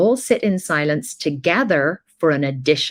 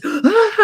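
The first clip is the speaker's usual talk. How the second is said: exasperated high cry; 4 dB louder than usual. Retold or imitated. Household reacting to the crying.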